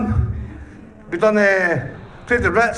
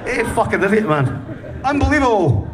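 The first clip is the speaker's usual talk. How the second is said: normal talking voice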